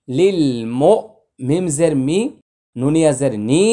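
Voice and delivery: usual speaking voice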